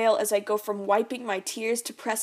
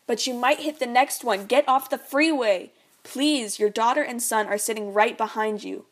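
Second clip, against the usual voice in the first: frantic pleading; 3 semitones higher, 6 dB louder than usual. Acted. Mom pleads with dad for their children, frantic. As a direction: beg him to think of the kids. Mom begging him to exit.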